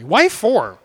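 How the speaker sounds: put-on "Why four?" said as a toddler says "why, for?"; toddler mispronunciation. Father copies his daughter asking "Why four?". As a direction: lightly mimic a three-year-old saying "Why four?". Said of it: "That's how she said it exactly".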